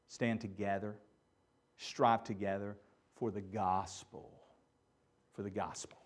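low, soft and urgent; a quiet rallying call to the church; subdued pastoral exhortation; earnest soft preaching. Real, not acted.

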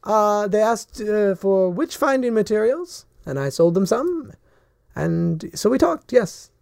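put-on high, sweet old shopkeeper lady; a chirpy old woman at her counter. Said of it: "old human lady".

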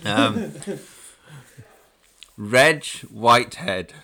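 slow, eating a biscuit; mouth full. Real, not acted. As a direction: speak through a mouthful. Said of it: "sorry, I'm eating a biscuit".

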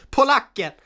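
high, loud blurted answer; shouted punchline. Real, not acted.

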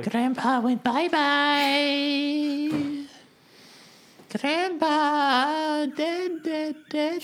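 very high, very slow squeaky eulogy. Acted. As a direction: wail it like a cartoon mourner. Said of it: "in that voice".

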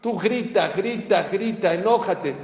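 hammed-up shouting; mock yelling. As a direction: act out an angry yeller, loud and high.

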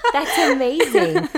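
laughing, high and drawn out; real laughter through slow, high words; amused delight. Real, not acted. Host reacting to guest's childhood contests, laughing delight.

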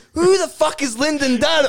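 high-pitched, outraged question; incredulous outburst. Acted. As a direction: demand it in acted bewilderment. Acted bewildered outrage.

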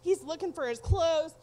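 normal talking voice

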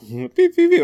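playful beep noises like a scanner; imitated beeping. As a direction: mimic the gizmo's beeping, beep beep beep.

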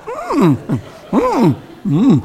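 high, drawn-out "hmm"; exaggerated interested hum. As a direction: hum as if trying hard to look engaged. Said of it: "practice different types of facial expressions".

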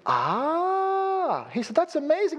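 high, drawn-out awed "Ah"; mock amazed caller. Caller hearing the reframe, hammed-up high awe. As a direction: play the caller suddenly dazzled.

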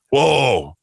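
usual speaking voice

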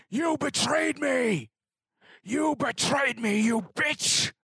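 slow, high furious yell; hollering at the sky. Acted. Salt hollers his betrayal up at the sky, slow and high. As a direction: scream the betrayal up at the heavens. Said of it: "hollering at the sky".

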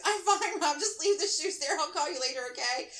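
high, hurried insistence; frantic reassurance. A narrator's reading. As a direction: insist you're fine so he will leave. Frantic answer through bathroom door.

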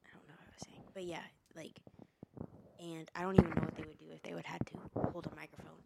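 hushed, fast whisper through a burning mouth. Real, not acted. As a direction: whisper it quickly, almost under the breath. Whispering while eating hot food.

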